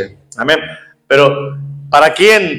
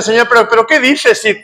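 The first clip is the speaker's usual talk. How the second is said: disciples objecting; 6 semitones higher than usual. Retold or imitated. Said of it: "los discípulos que estaban alrededor dicen".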